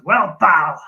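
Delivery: loud, hammed-up Scottish accent; put-on Scottish, punched out loud; mauled Scottish accent. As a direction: maul it in Scottish. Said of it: "that was me mauling the Scottish accent".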